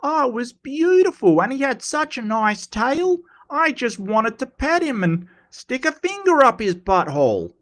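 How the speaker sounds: put-on high-pitched, broad Queenslander accent; Steve Irwin. Streamer demonstrating the Queenslander accent, high Steve Irwin impression. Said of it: "you can hear that Queenslander accent coming out".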